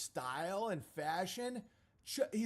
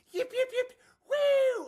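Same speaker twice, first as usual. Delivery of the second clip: high, loud coyote yips and whoop; yipping coyotes; 10 dB louder, slower than usual. Retold or imitated. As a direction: yip like a pack of coyotes celebrating. Imitated coyotes cheering.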